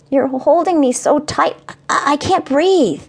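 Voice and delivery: put-on high, squeezed small boy; little boy voice. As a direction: gasp it out as a hugged kid. Child squeezed in a hug.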